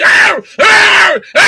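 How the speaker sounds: high, loud, angry growling "Arr!"; mock triggered roar. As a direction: ham up a furious, triggered person roaring. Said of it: "You're like".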